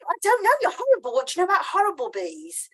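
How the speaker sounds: high, teasing bee voice; the cheeky bee. Retold or imitated. Bee spirit teasing the writer about horrible bees, high and mocking. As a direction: squeak it up as the playful bee. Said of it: "she was like".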